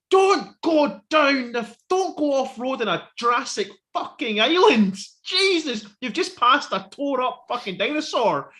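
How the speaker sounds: high, drawn-out exasperated yelling; screaming at the screen. Retold or imitated. Fan warning kids off the road in Jurassic World, exasperated yell. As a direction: yell a desperate warning at idiots in a film. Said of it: "you're screaming at the screen".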